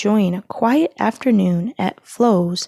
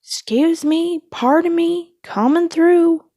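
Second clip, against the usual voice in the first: polite Mater; 9 semitones higher, 5 dB louder than usual. A narrator's reading. Mater rolling over cars.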